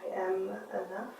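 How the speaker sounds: soft, slow inner affirmation; a quiet, slowed-down I am enough; inner thought. Shown as a demonstration. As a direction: murmur it like a thought to yourself. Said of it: "Maybe while we're here you think".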